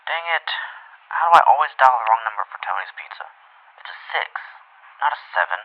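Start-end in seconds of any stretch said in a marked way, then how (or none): 3.8-4.3 hushed mutter to herself; whispered aside. Acted. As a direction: whisper it while fixing the mistake. Muttering to self.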